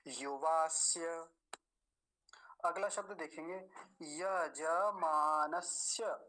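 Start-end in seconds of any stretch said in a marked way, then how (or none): 0.1-1.3 युवास्य drawn out with distinct स्य; clear final य. Shown as a demonstration. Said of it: "य का स्पष्ट उच्चारण आना चाहिए पीछे". 4.0-6.2 very slow यजमानस्य, syllable by syllable; full ज. Shown as a demonstration. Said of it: "जकार पूरा है".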